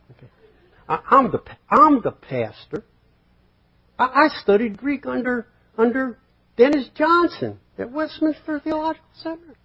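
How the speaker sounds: high, self-important mock pastor; pompous pastor pulling rank. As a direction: puff up and pull rank. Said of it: "You can't pull rank".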